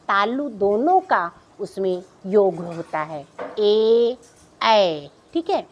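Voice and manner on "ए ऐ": ए, ऐ drawn out and louder, sounded from throat to palate; teacher sounds ए, ऐ slowly and firmly; throat and palate vowels. Shown as a demonstration. Teacher demonstrates the vowels for children, slow and loud. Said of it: "तो गले से भी आ रहा है और plus इसको तालु से भी".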